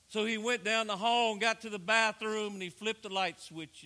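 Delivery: normal talking voice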